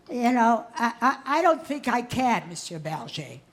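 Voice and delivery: normal talking voice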